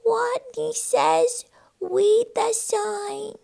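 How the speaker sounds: high, slow, tiny rodent voice; small trembling rodent's squeaky voice; timid squeak. Acted. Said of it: "and squeaked".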